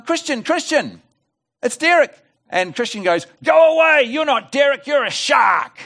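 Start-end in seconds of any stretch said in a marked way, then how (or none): 0.0-2.2 much higher, slow calling voice; calling at the door. Acted. Derek knocking, calling his friend. 3.4-5.8 much higher, louder panicked cry; scared prawn shouting at a shark through the door; frightened shout. Acted.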